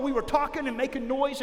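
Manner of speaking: usual speaking voice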